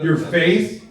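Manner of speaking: usual speaking voice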